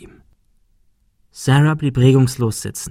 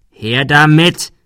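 loud, slow, raised command; angry demand; 4 semitones higher, 7 dB louder, slower than usual. A narrator's reading. Bully orders hesitating girl to hand it over, angry. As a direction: demand it, stretching each word.

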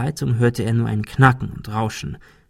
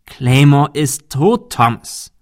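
slow, louder, each word weighted; emphatic; 3 semitones higher, 5 dB louder, slower than usual. Acted. Insisting to Thomas that Claymore is dead, emphatic. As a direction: lay it down firmly, slowly.

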